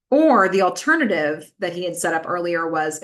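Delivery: ordinary delivery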